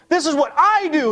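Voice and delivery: "I" punched high and loud, drawing attention to oneself; emphatic self-reference. Acted. Preacher voicing Paul calling attention to himself, emphatic. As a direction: lean hard on "I", pitched well up. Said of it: "He's calling attention to himself".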